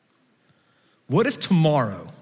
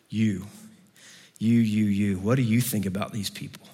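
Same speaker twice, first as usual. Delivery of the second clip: honest prayer; 6 semitones lower, 3 dB quieter than usual. Retold or imitated. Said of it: "be okay with some honest prayer".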